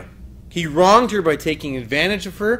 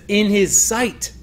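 "In his sight" higher and slow; stress on sight; 5 semitones higher, 4 dB louder, slower than usual. Retold or imitated. Teacher stressing Nathan's phrase to the counselors, higher and slow. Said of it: "look at what Nathan says here".

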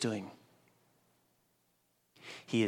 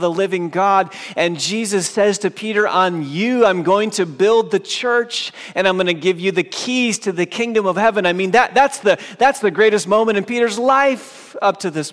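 loud, high, driving sermon voice; fervent preaching; 8 semitones higher, 10 dB louder than usual. Acted. Preacher telling the congregation about Peter, fervent and loud. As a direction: preach it out to the whole church.

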